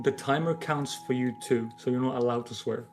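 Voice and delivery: ordinary talk, nothing marked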